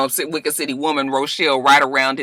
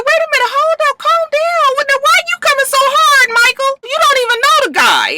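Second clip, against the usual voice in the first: high-pitched, heated pushback; worked-up objection; 3 dB louder than usual. Real, not acted. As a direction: cut him off, high and indignant. Defending the guy against Michael.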